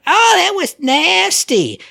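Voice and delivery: high, slow mocking squeal of a teenage girl. Mocking the teenage girls in the theater, high drawn-out squeal. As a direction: drag it out like a whiny teenager. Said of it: "one went".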